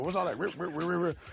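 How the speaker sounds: high-pitched baffled complaint with scratch noises; mock clueless listener. Rapper imitating people confused by scratching, mock high-pitched complaint. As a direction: imitate an outsider griping at record scratching. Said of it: "I've seen people go".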